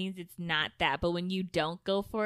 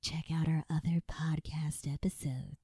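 whispered sign-off; 3 semitones lower, 3 dB quieter than usual. Acted. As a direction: breathe the words, no voice.

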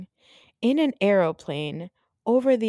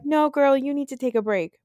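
mock bossy Marlo; 7 semitones higher than usual. Marlo ordering Kandi to rest, mock bossy. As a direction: play Marlo lecturing, hammed-up and bossy. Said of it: "And Marlo's like".

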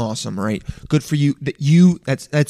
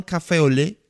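put-on show-off French accent on "café au lait"; mock-authentic French "café au lait"; over-the-top French pronunciation. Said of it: "say every word from other languages like that".

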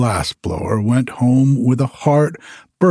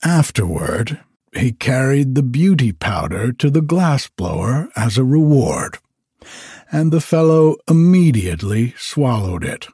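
plain reading voice

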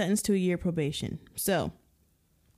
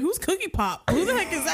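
higher baffled mom voice; confused mom voice; 8 semitones higher than usual. Retold or imitated. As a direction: play a clueless mom, squeaky. Parent voice imitation.

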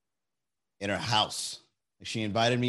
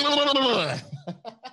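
loud, high gibbering noise; mock-crazed wordless babble; frenzied babbling; 13 semitones higher, 3 dB louder than usual. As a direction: gibber manically after a goading yell. Frenzied goading imitation.

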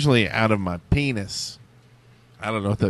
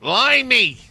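a high, loud attempt at a Scottish accent, badly done; 10 semitones higher, 4 dB louder than usual. Answering a listener's request for a Scottish accent, bad Scottish accent. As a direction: ham up a Scottish exclamation, clearly failing. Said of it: "it's supposed to be Scottish".

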